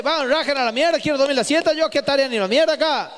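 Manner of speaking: high-pitched mock angry dad; irate father. Father kicks the boys out of his siesta room, mock irate. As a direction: act out a dad throwing the kids out.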